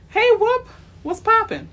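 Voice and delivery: loud, high, thrilled hello; excited shout. Real, not acted. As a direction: yell out a happy greeting. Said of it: "Get a little excited sometimes".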